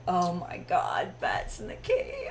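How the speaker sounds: laughing. Retold or imitated. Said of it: "I'll just be like".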